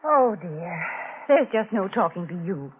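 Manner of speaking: normal talking voice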